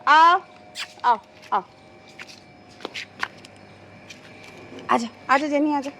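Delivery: loud coaxing call to a runaway goat. Real, not acted. Calling Jenny the goat back indoors, loud coaxing call. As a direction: sing out her name to lure her back.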